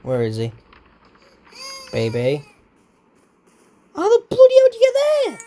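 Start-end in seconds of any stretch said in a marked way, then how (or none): 3.9-5.4 much higher, louder, fast disbelief; high rapid incredulous outburst; exasperated shriek. Real, not acted. Baby escaped again.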